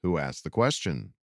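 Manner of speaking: ordinary talk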